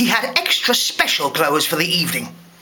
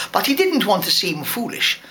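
ordinary delivery

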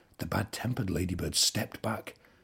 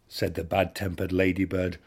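usual speaking voice

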